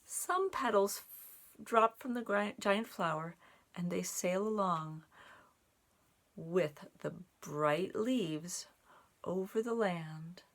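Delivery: put-on slow, gentle read-aloud for children; story narration. A narrator's reading. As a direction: draw the little ones into the tale, unhurried. Reads a picture story aloud.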